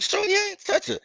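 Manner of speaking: very high, pleading denial; high incredulous protest. Retold or imitated. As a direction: protest innocence in a squeaky panic. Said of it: "I'm like".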